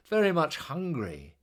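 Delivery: plain reading voice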